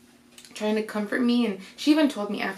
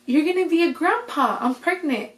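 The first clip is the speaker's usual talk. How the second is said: higher-pitched, lilting reveal; retold happy announcement; 7 semitones higher, 3 dB louder than usual. Daughter tells dad she is pregnant, brightly excited. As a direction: act out breaking big news to Dad.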